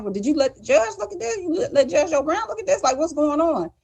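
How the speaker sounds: high, fast, disbelieving questions; retelling her stunned reaction to the attorney; incredulous questioning. Retold or imitated. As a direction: fire off the questions in shocked disbelief. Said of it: "And I'm like".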